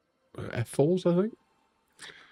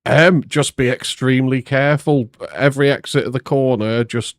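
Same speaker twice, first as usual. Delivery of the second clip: loud; 7 dB louder than usual. Real, not acted. Live interview answer.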